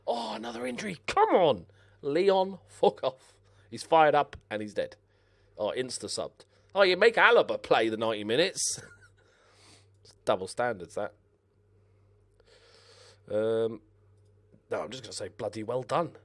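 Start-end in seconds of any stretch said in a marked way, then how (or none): none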